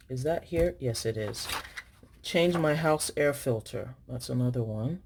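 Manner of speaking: ordinary talk, nothing marked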